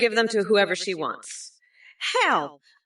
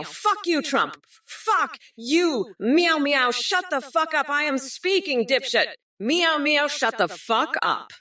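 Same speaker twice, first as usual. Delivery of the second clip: high-pitched cursing tirade; angry rebuke; 6 semitones higher than usual. Acted. Candidate silencing Trump, angry rebuke. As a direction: tell off a rival, pitch raised.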